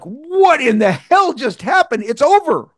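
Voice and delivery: high, slow, amazed; mock astonishment at escaping; stunned disbelief. Retold disbelief after deal.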